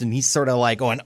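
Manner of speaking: ordinary delivery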